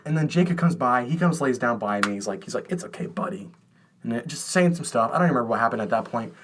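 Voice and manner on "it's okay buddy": whispered, gentle reassurance to a friend; soft comforting whisper. Retold or imitated. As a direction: hush it like you're calming a sad buddy. Friend comforting him on bed.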